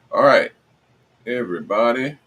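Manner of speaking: loud hype call to the crowd; loud fast street hustling. Real, not acted. Hyping the live stream opening.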